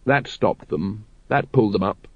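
usual speaking voice

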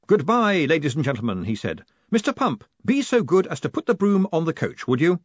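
bright, loud cheery farewell; showy cheerful. Acted. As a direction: play it up for the crowd. Moist performing for crowd.

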